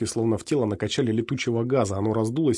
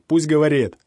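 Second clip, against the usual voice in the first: commanding shout; 5 semitones higher, 7 dB louder than usual. A narrator's reading. Rusak commands crowd.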